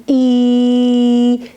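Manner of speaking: "e" held long as a steady ee; drawn-out vowel. Shown as a demonstration. Teacher shows learners the vowel can continue, long drawn-out "e". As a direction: stretch "e" into one long, unbroken vowel. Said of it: "We can continue the vowel".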